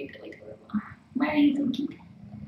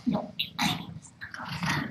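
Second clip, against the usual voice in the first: whisper; 11 dB quieter than usual. Real, not acted. Whispered plea for snuggles.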